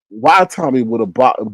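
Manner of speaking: ordinary talk, nothing marked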